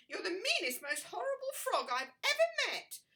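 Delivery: high, loud angry yell; angry shout. Acted. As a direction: yell it at the horrible frog. Poppy yells at frog.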